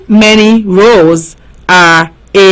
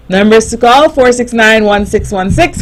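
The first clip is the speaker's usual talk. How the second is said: ordinary talk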